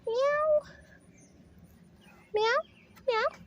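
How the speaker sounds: high, loud playful meows at the kitten; cat meows. Pretend. Meowing high to lure the kittens. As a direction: call the kitten with squeaky meows. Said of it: "meowing at the kittens".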